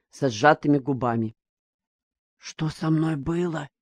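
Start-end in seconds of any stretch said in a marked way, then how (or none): 2.4-3.7 hushed, guilty whisper. A narrator's reading. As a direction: barely breathe the question. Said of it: "спросил шёпотом Мигдя".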